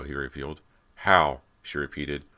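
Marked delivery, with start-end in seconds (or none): 1.0-1.4 loud, one-word challenge; skeptical demand. A narrator's reading. Callie disbelieving Brant's revelation, loud skeptical question. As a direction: fire the question back forcefully. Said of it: "her eyes locked skeptically on his".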